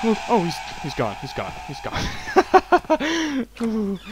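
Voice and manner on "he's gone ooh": high-pitched relief breaking into laughter; giddy, relieved hysterical giggle. Real, not acted. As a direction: crack up once the danger has gone. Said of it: "hysterical laughter".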